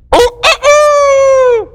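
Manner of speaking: high, drawn-out rooster call; imitated rooster crow. Rooster crowing after Peter's third denial, imitated crow.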